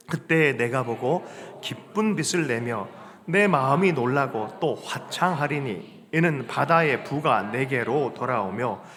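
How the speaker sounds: slow, measured recitation; scripture reading. Acted. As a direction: read the verse solemnly and slowly. Reads scripture aloud. (성경 구절을 천천히 엄숙하게 낭독하듯)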